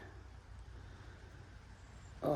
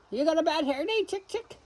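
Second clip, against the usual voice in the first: very high, soft cooing question; 11 semitones higher, 6 dB quieter than usual. Real, not acted. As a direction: coo sweetly at the hen. Talking to fluffy chickens.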